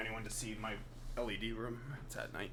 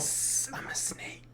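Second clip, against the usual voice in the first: hissing whispered snake; much slower than usual. Pretend. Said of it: "the snake voice".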